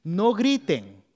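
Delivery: loud, slow command; loud slow biblical command. Acted. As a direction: order the army to keep silent.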